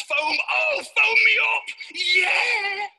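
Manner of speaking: high shouted pump-up; over-the-top fired-up yelling; manic hype. Acted. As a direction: build to a high, hollered "yeah". Acts out fired-up hand washing.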